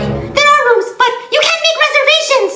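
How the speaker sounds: very high, fast squeaky imitation; squeaky mocking clerk. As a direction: mimic the clerk in a silly falsetto.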